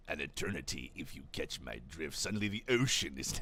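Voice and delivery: low, hushed villain impression; Palpatine. Narrator slips into an evil emperor, villain impression. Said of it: "That's not really good at Palpatine".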